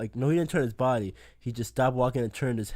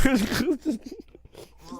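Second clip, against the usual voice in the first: ordinary delivery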